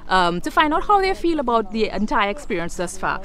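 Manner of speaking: presenter intro. Acted. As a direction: introduce the tour to viewers. Host's on-camera intro.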